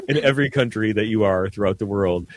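normal talking voice

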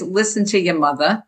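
MOTHER as "mutha", Jersey style; Jersey mom's MOTHER, R dropped; Jersey accent. Shown as a demonstration. Chapter title from mother's saying, Jersey accent. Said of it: "M U T H A".

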